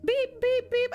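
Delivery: high-pitched beeps through pretend tears; mock sobbing beeps. Clerk crying at the register, mock tearful beeps. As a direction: mimic scanning while crying. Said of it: "I'm openly sobbing".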